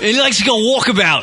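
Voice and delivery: put-on Australian accent. Host keeps riffing on the caller in a mock Australian accent. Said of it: "and did you have to do the accent?".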